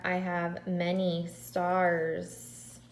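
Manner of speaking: slow stars with the final S drawn out; stressing the plural. Shown as a demonstration. Teacher modelling plurals for kindergartners, slowly. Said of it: "So I add an S".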